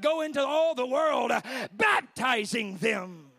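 loud proclamation, slowing on the last words; forceful preaching quote. Retold or imitated. Preacher quoting Jesus to the church, forceful proclamation. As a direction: thunder it out like a commandment.

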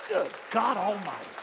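ordinary delivery

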